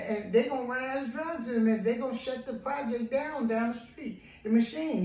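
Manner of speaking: high-pitched, urgent begging for help; acted frantic plea. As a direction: play a desperate man stumbling over his words. Trapped man pleads with third passer-by.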